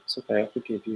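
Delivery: soft, reassuring murmur; tender forgiveness. Retold or imitated. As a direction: gently let a child off. Parent forgiving child, retold.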